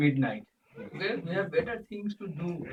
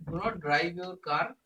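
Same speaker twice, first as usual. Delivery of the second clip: ordinary delivery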